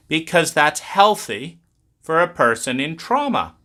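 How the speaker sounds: ordinary talk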